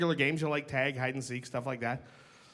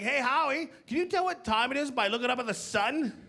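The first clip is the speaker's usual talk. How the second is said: put-on kid friend voice; 7 semitones higher than usual. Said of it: "They'd be like".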